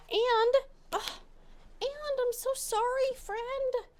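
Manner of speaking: high, far cutesy pet voice. Real, not acted. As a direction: coo an apology to the cat. Apologising to the cat.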